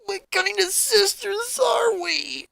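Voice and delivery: high, slow, cracking tearful voice; cracked crying voice. Acted. Crying sister asks what kind of sisters they are, cracking voice. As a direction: let it scratch and crack with tears. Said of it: "sounding like a scratched record".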